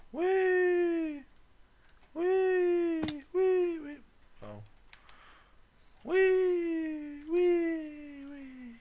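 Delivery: very high, squealing whee; gleeful whee. Acted. Gamer squealing as his character jumps. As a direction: squeal like a kid on a slide. Jumping in the game.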